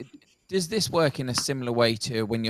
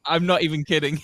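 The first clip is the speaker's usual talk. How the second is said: really laughing through the words, pitched higher; 6 semitones higher than usual. Real, not acted. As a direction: insist through helpless laughter. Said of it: "I'm laughing at myself".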